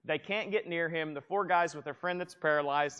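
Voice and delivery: ordinary talk, nothing marked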